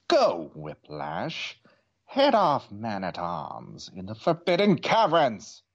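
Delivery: commanding evil overlord; Skeletor voice. A narrator's reading. Skeletor orders servant.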